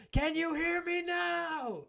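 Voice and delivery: slow, drawn-out high wrestler taunt; Brock Lesnar voice. Retold or imitated. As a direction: stretch it out like a wrestling promo. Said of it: "That's an old Brock Lesnar quote".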